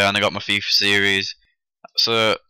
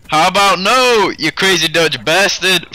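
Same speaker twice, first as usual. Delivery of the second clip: much higher, louder, slow mock comeback; Austin Powers quote; 9 semitones higher, 5 dB louder, slower than usual. Quoting Austin Powers at the homeless man, high, loud and slow. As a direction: drawl it out like a movie hero's put-down. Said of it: "from Austin Powers".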